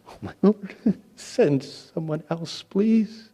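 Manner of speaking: high, loud, slow begging; pleading whine. Acted. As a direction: beg to get out of the job. Moses begs to be excused.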